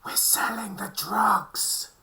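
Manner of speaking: slow, hissed stage whisper; drawn-out secretive whisper; conspiratorial whisper. Acted. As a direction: lean in and whisper it slowly, like a secret. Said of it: "Kara leans in and whispers".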